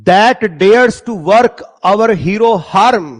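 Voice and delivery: slow, loud recital of the line; slow poem recital. Shown as a demonstration. Teacher reciting poem line slowly.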